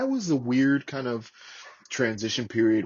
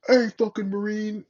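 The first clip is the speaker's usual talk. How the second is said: higher, louder call of a sergeant yelling at a stray Marine; mock yell; 11 semitones higher, 4 dB louder than usual. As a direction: bark it across the lot. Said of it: "if someone would yell that at me".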